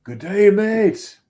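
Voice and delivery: put-on loud, high, drawn-out Australian accent; mock Australian greeting. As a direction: ham up a slow Aussie hello. Echoes guest's Australian greeting.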